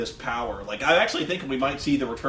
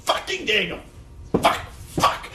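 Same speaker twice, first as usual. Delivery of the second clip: wanting to curse; 5 semitones higher than usual. Retold or imitated. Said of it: "he wanted to curse".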